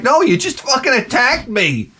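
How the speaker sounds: high-pitched mock indignation; indignant retort. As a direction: accuse the dog in comic disbelief. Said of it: "I'm like".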